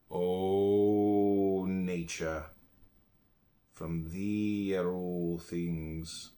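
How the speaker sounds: drawn-out, prayer-like chant, each word held long; slow reverent invocation. Acted. Speaker addressing nature in prayer, slow and reverent. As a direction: intone it slowly like a hymn to nature.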